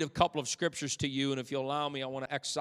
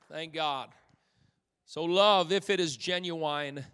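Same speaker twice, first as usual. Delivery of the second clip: ordinary delivery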